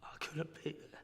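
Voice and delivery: high, voice nearly gone with grief; real grief catching in the throat; choked up. Real, not acted. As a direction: struggle to get the words out. Grieving over father's death.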